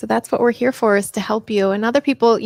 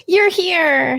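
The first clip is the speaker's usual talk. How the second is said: delighted surprise; 9 semitones higher than usual. Real, not acted. Spots viewer already in stream.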